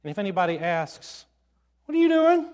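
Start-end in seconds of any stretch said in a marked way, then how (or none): 1.9-2.5 high, quick challenge; startled challenger. Acted. Someone asking the disciples about the colt, high challenge. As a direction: voice a bystander catching someone at it, pitched up.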